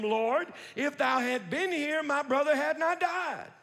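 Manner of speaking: Mary's loud grief-stricken plea; anguished cry. Acted. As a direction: cry it out at his feet in grief. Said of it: "read it with me".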